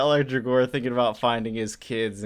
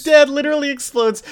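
excited disbelief; 9 dB louder than usual. Real, not acted. Laughing about gruesome comic scene.

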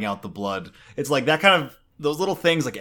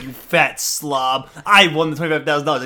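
mock-furious gloating, building in volume; retold yelling; 6 dB louder than usual. Wife telling her husband she won the money, mock-angry yell. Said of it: "she's just yelling at him".

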